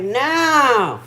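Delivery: high, loud, scornful retort; mock outrage at whiny nurses; indignant rant. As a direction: throw it back at them, fed up. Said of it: "and I'm like".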